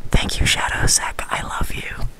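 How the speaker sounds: slow, unvoiced whisper; whispered thanks. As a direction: whisper it intimately to one viewer.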